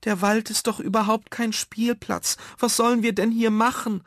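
put-on high, loud whiny child; whining complaint. Bored child objects to the forest, whining. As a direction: protest in a high-pitched whine.

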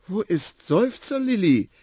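put-on high, bright child-elephant question; young elephant asking. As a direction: ask it eagerly like a young elephant. Young elephant Quawa asks. (hohe, neugierige Elefantenkinderstimme)

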